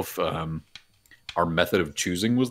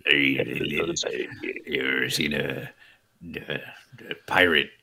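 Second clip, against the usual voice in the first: pirate voice; 5 semitones lower, 3 dB quieter, slower than usual. Acted. Pirate impression starts.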